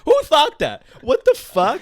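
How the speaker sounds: high, loud outraged disbelief; can't believe who let that happen. Real, not acted. Disbelief at being kicked out.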